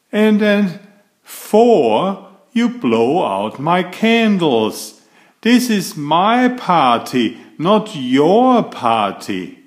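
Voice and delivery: fed-up complaint. Acted. Brother scolding his sister at his party, rising and cross. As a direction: count off each grievance, getting crosser.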